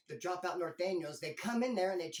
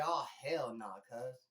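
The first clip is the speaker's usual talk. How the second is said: lower, altered voice, disgusted "Oh, hell nah, cuz."; imitating neighbor's disgust; 6 semitones lower, slower than usual. Neighbor below as the mess hits his cell, mock disgusted. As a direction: play the guy below seeing it come out. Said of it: "the dude underneath me said".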